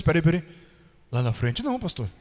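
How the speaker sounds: shy man. Retold or imitated. Shy miner refusing to go up front: low timid mumble. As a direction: back away from the spotlight, mumbling. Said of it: "Meio tímido assim".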